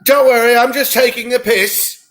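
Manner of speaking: camp it up as a Cockney; put-on British accent. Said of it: "flex your British accent".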